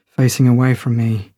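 usual speaking voice

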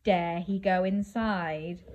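ordinary delivery

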